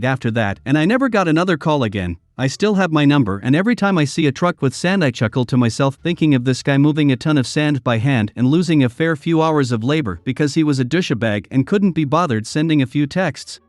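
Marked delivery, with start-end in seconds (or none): none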